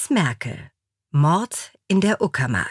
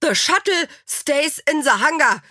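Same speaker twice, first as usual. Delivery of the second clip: high, emphatic order; firm command; 10 semitones higher, 4 dB louder than usual. A narrator's reading. Angela stopping the shuttle plan, high firm command. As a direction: lay down the law, pitched high.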